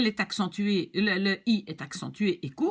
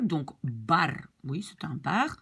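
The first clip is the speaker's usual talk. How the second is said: normal talking voice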